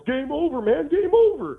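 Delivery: high-pitched mock yell, quoting a film; mock yelling, pitch jumping way up; movie character's panicked yell. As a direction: cry it out high, imitating the movie line. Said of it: "the character played by Bill Paxton who yells".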